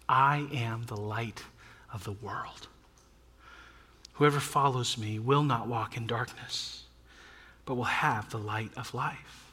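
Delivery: slow, low, soft proclamation; hushed, measured verse; solemn scripture reading. Retold or imitated. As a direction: read it softly and low, with weight. Solemn reading of Jesus' words.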